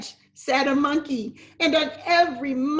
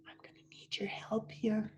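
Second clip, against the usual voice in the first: whisper; 2 semitones lower, 12 dB quieter, faster than usual. Real, not acted. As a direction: lean in and whisper. Teacher whispers to children.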